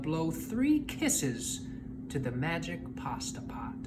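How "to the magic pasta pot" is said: hushed storyteller whisper; softly whispered aside. A narrator's reading. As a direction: breathe it like a secret.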